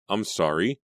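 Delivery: plain reading voice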